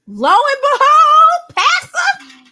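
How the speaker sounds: high, loud, slow sing-song flourish; mock dramatic proclamation. Streamer mocking the president's passive prayers, grand slow proclamation. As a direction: announce it like a grand reveal, hammed up.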